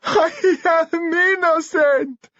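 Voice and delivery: very high, slow tearful wail; sobbed. A narrator's reading. Dimitri bursts into tears before the police, sobbing. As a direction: drag it out as a sobbing lament. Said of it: "he sobbed".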